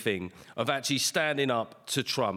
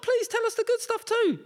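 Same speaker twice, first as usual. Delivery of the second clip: much higher, whiny mock plea; pleading. Comedian begging the news for good stories, squeaky and high. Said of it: "I'm like".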